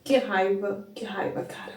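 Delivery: tearful. Real, not acted. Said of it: "estou chorando também".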